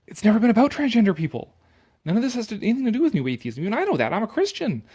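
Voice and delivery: sarcastic impersonation of the essayist; mock author voice. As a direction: play the smug writer. Voicing the author, mocking.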